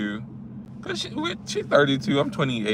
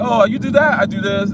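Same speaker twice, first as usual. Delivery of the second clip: mock excited chatter; 7 semitones higher, 3 dB louder than usual. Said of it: "it was just like".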